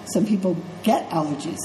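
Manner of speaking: ordinary delivery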